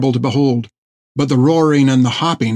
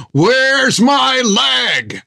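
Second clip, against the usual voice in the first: much higher, slow bellow of rage; furious shout; 12 semitones higher, much slower than usual. Acted. Jack flails his stump and roars for his leg, a slow furious shout. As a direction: roar it out, drawn long. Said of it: "resumed his cry of".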